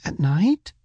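put-on high-pitched child's short question; doubting boy. A narrator's reading. Boy questions father's night task, high doubting voice. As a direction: voice a puzzled boy, pitched well up.

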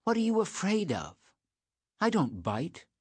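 high, bold challenge without real shouting; loud, bold voice. A narrator's reading. Girl daring boy to come closer, emboldened. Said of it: "she said in a loud voice".

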